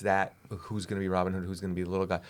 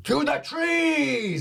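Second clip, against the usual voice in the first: high, loud, stretched-out shout; yelled battle cry; 5 dB louder, much slower than usual. Acted. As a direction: holler it with long held words. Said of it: "He just yells".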